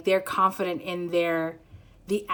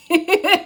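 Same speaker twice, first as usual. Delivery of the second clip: higher, loud giggle; giddy laugh; 9 semitones higher, 8 dB louder than usual. Real, not acted. Reader overcome with giddiness, giggling. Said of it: "I feel giddy".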